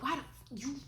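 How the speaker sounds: a hint of his cursing rage; imitating mad ex. Retelling her ex's phone rant, mildly mock angry. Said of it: "he was mad".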